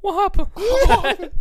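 high drawn-out mock "Wahapa"; silly high squeak. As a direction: squeak it like a girl would. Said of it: "they're like".

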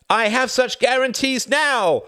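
high, loud, slow cry of despair; a desperate president crying out. Acted. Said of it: "cried Bennis in despair".

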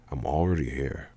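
lightly voiced low, quiet indifferent man; unenthusiastic Sajin. Man announcing he is already there, indifferent.

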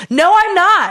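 high, loud indignant retort; pissed-off denial. Retold or imitated. Sick woman denying it to her partner, pissed off. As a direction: snap back high and loud, offended. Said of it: "I got so pissed".

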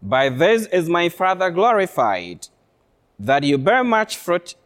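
ordinary talk, nothing marked

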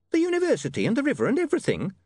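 fast, high and anxious; a worried landlady asking quickly; nervous landlady. A narrator's reading. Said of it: "said the landlady nervously".

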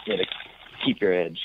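usual speaking voice

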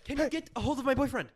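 put-on high, loud whiny French Canadian accent; xQc French Canadian accent. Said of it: "his stupid French Canadian accent".

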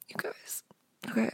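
hushed aside to viewers; whispering. Real, not acted. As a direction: drop to a whisper.

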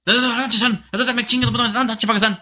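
high-pitched, ranting mock shopkeeper; angry fast-talking shopkeeper. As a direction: rattle it off in a heated, high jabber. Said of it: "they talk a little fast".

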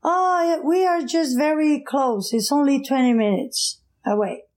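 put-on lightly Portuguese-accented driver promising it is close. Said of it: "in his accent, Portuguese accent".

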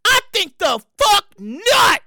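very slow, spaced words, NOT stretched out; indignant refusal. Real, not acted. Host repeating refusal to pay for fillings, indignant. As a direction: measure out every word and drag NOT.

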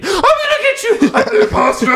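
high laughing imitation of a screaming kid; mock scream. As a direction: yell it like a kid gone wild, laughing through it. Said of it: "like, screaming".

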